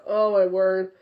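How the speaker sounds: ordinary talk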